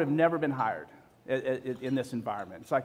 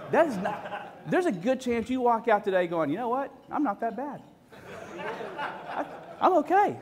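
laughing mock relief; 8 semitones higher than usual. Mocking audience relief, laughing.

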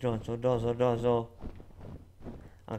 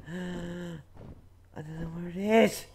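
stunned gasp; 10 dB quieter, much slower than usual. Real, not acted. Gasps, egg vanished.

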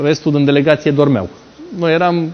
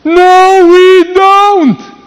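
loud high drawn-out retort; full-volume comeback holler; yelling back; 10 dB louder, much slower than usual. Retold or imitated. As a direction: scream it back with all the lungs you've got. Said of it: "pot și eu să țip".

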